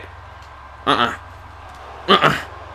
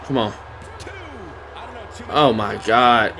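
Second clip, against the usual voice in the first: ordinary talk, nothing marked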